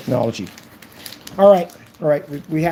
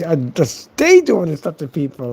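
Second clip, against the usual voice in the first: ordinary talk, nothing marked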